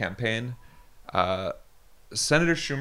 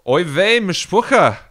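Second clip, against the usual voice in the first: put-on loud, high Yiddish-accented scolding; 7 semitones higher, 6 dB louder than usual. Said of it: "speak to you in the mother tongue, Mamaloshin".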